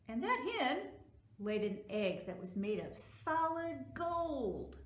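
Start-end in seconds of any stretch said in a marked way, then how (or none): none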